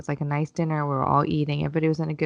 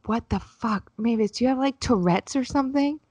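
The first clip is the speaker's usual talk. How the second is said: mock blurting out; 6 semitones higher than usual. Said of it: "finally I was like".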